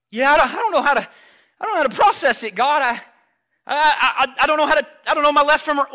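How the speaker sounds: loud, high, stammering outburst; flustered, overwhelmed reply. Acted. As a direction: stumble over the words, overwhelmed and pleading. Acts hurt child answering the Father.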